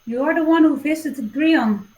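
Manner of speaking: speaking up. Acted. Player addresses the druid lady in game, speaking up. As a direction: address her directly, voice lifted. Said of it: "I'll just speak up to the lady".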